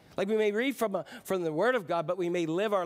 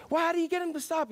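lightly voiced buyer; 10 semitones higher, faster than usual. Dialogue voiced higher.

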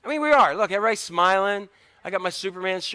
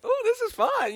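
far higher, mock-sweet; sarcastic cheer. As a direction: sing it out with fake enthusiasm. Kids' mock enthusiasm.

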